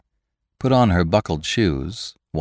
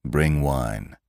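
low, sullen order; 5 semitones lower, 4 dB quieter than usual. A narrator's reading. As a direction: mutter it sullenly, soft. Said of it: "call out in a most sullen fashion".

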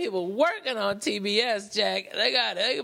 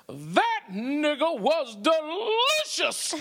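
higher, slow, satisfied pronouncement; deadpan punchline quote; 7 semitones higher, slower than usual. Retold or imitated. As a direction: lay it down slowly like a contented diner. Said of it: "puts his napkin on the plate and goes".

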